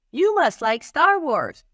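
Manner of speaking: put-on bright eager kid guessing out loud; child's voice.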